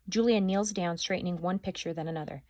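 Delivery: plain reading voice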